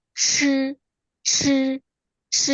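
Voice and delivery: "ch" with the I turned to a dull e-like vowel; tongue-curled "ch", I not said as ee; curled-tongue ch sound. Shown as a demonstration. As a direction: hold "ch" on a high level pitch, vowel like a muted e. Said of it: "huruf I juga berubah menjadi E".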